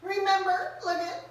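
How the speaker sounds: high, fast, eager coaxing; excited to the audience. Real, not acted. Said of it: "asks viewer to draw".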